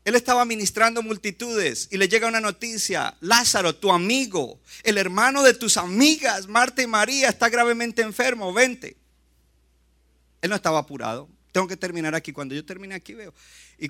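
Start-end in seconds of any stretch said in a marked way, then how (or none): 3.2-9.0 higher, louder, pressing news; urgent message. Retold or imitated. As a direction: deliver the bad news urgently. Messenger urgently summons Jesus.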